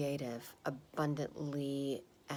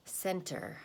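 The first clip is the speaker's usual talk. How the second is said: usual speaking voice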